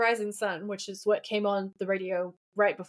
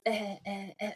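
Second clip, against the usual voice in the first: imitated sputter of a stalling car; short, soft engine-dying "eh" noises; 7 dB quieter than usual. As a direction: mimic a car giving out. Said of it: "because she goes".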